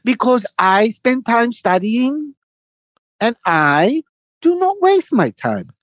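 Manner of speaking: high, very slow proud lecture; hammed-up boastful older man; mimicking him boasting. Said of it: "And he goes".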